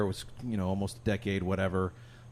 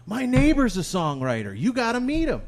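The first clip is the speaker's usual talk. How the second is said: high, loud, imitating an eager stranger; hammed-up excitement, pitched way up; excited woman; 11 semitones higher, 4 dB louder than usual. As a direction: gush it like an enthusiastic matchmaker. Woman excitedly recommends her neighbor.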